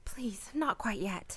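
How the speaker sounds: slow, unvoiced pleading whisper; whispered plea. Laura pleading with Alec not to leave yet, whispered. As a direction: beg him softly on a breath.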